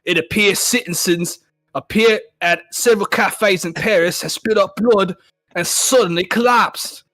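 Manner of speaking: hammed-up British newsreader drifting Australian; mock British accent. Reader voicing a BBC special report, wobbly British-Australian accent. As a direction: do a bad British accent, reporting grave news. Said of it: "You made him Australian as fuck".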